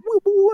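very high woo woo imitating the missile defence; mock gadget whoop. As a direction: squeal it like a silly sound effect. Batman's gadget mimicked.

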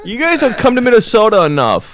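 put-on high, loud cranky old man. Imitating Sid again.

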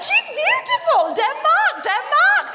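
very high, drawn-out mock panic; panicked crisis. Friend covering an awkward question about sperm, hammed-up high panic. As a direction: squeal it like a flustered friend in meltdown. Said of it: "a crisis, an existential crisis of some sort".

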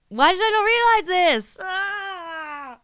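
loud, high-pitched cry of frustration; exasperated wail. Retold or imitated. Host realizes the obvious cause of the headache, exasperated high wail. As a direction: wail it, ending in a drawn-out scream. Exasperated at missing caffeine withdrawal.

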